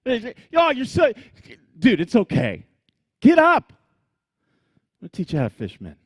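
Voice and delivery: high, loud playful mockery, easing into a friendly reassurance; Jesus teasing Peter, then reassuring him. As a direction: gently poke fun at Peter, then pick him up. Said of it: "Jesus is making fun of him".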